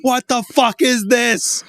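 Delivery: high, loud, drawn-out bewildered yell; retold yelling. Kid confronting the strange new console, yelling. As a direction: yell it slowly like a monkey at the monolith. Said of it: "just, like, yelling at it".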